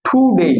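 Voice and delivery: টুডে with breathed t, "THU-dey"; corrected pronunciation. Shown as a demonstration. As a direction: stretch টুডে with aspirated t. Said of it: "আমরা বলি টুডে".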